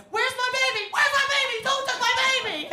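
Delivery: high panicked shrill mother; frantic mom. Retold or imitated. Frantic mother voiced in story.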